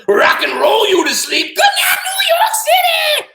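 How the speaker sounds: high, loud, drawn-out concert yell; rock star shout. Acted. Octopus rocks boy to sleep, screaming rock star. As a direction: belt it like a rock frontman.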